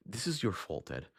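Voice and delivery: ordinary delivery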